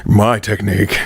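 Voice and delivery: low, deliberate deep voice. Shown as a demonstration. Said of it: "deeper pitch voices".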